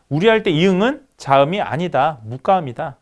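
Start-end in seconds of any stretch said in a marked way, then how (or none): none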